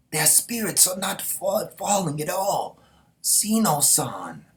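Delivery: high, slow, loud marvel; awed report. Acted. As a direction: tell the commander their spirit won't break. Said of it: "we see Miran".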